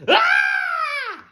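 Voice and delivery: very high, loud long shriek; terrified scream. Acted. Both creatures frightened of each other, screaming. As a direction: scream as long as you can. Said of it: "both screamed".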